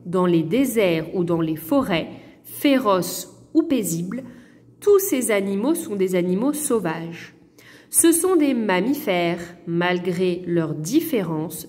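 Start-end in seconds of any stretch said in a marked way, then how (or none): none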